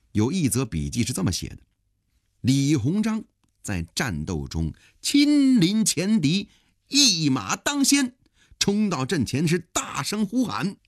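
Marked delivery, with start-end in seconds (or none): 2.4-10.8 high-pitched, heroic storyteller build-up; pingshu storytelling. A narrator's reading. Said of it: "讲得跟评书似的". (评书腔，高亢地讲述英雄冲阵)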